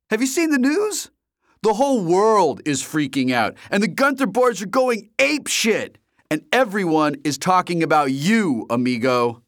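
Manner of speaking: high, loud, wild rant; excited friend H. A narrator's reading. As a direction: rant at your buddy for not calling.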